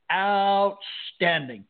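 very slowly stretched word; drawn-out reply. Retold or imitated. Driver answering a guard's how-are-you, slowly drawn out. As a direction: draw the word right out. Acting out own hearty reply.

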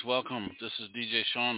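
normal talking voice

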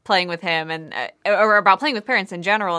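ordinary talk, nothing marked

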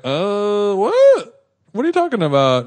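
high-pitched mock-innocent squeak; hammed-up innocent confusion; feigned ignorance. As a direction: play dumb, voice leaping way up. Feigning ignorance about the hidden soju.

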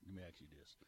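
low, hushed Cajun brogue. Acted. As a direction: slip into a quiet Louisiana Cajun accent. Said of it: "the brogue down there".

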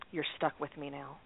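low, quiet deadpan; deadpan serious. Retold or imitated. Partner greets her in the car after the ruling, very serious. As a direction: say it soft and grave, imitating a partner. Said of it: "with this very serious look on her face".

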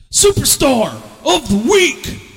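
high, loud, slow host announcement; announcer call. Acted. Host calling out the segment to listeners, drawn-out announcer voice. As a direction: boom it like a show announcer.

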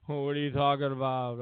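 a hint of a low [name] growl; attempted [name] voice. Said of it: "how to do a [name] accent".

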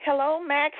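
ordinary talk

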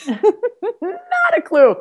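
very high and drawn out, laughing. Real, not acted. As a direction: stretch it out in laughing disbelief. Laughing at her cluelessness.

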